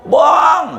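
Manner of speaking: high, loud holler across a big house; shouted call. Shown as a demonstration. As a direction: yell out for someone far away. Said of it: "pakai teriak".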